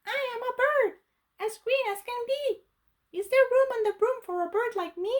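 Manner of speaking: high, quick chirpy bird voice; high fast birdlike plea. Acted. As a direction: chirp it out like an eager green bird. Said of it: "then said".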